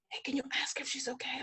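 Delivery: hushed, quick whispered aside; discreet whisper. Retold or imitated. Photographer privately asking after crying model, discreet whisper. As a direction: whisper it fast to a colleague.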